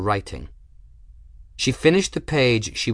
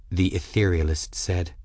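ordinary delivery

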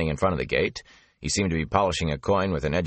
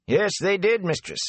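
higher, folksy gossiping gatekeeper; a garrulous country man passing on rumours; chatty rustic gatekeeper. Acted. Avin answers Moraine.

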